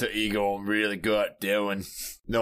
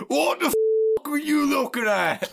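mock yell; 4 dB louder than usual. Slash acting tough at the officer.

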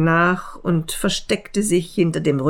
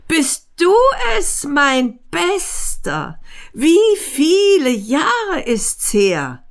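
higher, slowed, delighted questions; fat man overjoyed; 9 semitones higher than usual. A narrator's reading. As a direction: greet a long-lost friend warmly. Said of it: "rief der Dicke".

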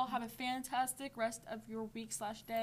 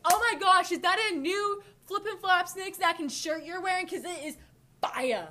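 loud, high, gushing excitement; over-the-top genuine compliment; 7 semitones higher, 6 dB louder than usual. Shown as a demonstration. Podcaster showing listeners how to compliment, wildly enthusiastic. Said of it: "You have to be like".

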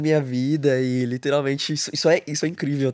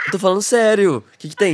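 higher, louder protest of sincerity; mock indignant insistence; 7 semitones higher, 4 dB louder than usual. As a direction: insist loudly, as if wrongly doubted. Insisting on a prank lie.

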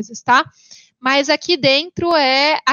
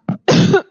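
choking cough on water; coughing after choking; 5 dB louder than usual. Real, not acted. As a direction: cough from swallowing wrong. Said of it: "eu engasguei aqui com a água".

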